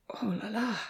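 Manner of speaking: soft dismayed moan, in a changed voice. A narrator's reading. Professor watching Amy with Damien, soft moan. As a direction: moan it in mock despair. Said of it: "the professor moaned".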